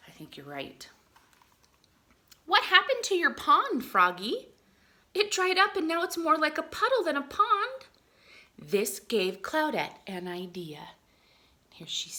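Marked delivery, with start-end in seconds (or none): 2.5-7.9 higher, louder storybook voice; bright character voice. Acted. Teacher voicing the cloud asking the frog about its pond, higher and louder. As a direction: read the exchange with lively character energy.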